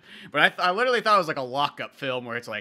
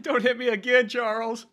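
mock pleading; 7 semitones higher than usual. Mock plea to an attacker.

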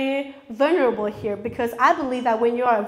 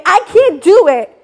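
defeated cry; 5 semitones higher, 8 dB louder than usual. Pretend. Voicing a defeated thought.

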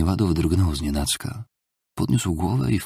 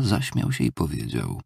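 plain reading voice

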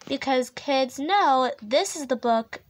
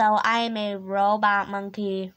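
ordinary delivery

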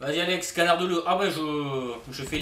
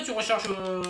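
usual speaking voice

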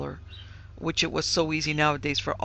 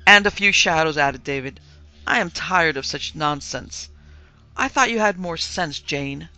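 exasperated scolding; 8 dB louder than usual. A narrator's reading. Husband scolding family.

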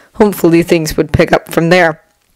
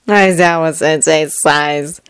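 ordinary delivery, nothing marked

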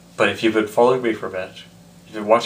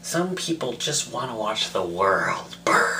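slow whispered villain rasp; Joker impression; 4 dB quieter than usual. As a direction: relish the chaos, slow and hushed. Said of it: "sounded terrible".

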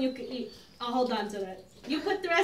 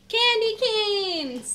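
louder, drawn-out excited call; excited announcement; 5 semitones higher, 6 dB louder, much slower than usual. Real, not acted. Big sister announcing candy canes to the little ones, excited. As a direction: reveal the treat with glee.

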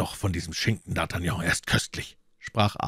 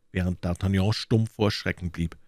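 ordinary delivery

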